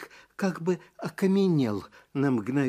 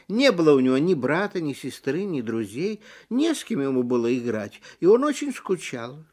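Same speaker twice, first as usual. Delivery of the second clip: usual speaking voice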